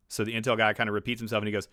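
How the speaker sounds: ordinary delivery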